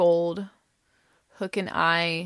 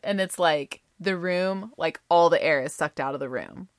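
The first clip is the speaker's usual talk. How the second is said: ordinary delivery, nothing marked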